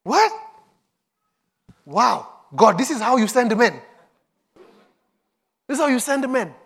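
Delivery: high, amazed disbelief; incredulous. Retold or imitated. Preacher recalling his own astonishment at how God works. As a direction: marvel at God's odd ways, pitched high. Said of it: "I was like".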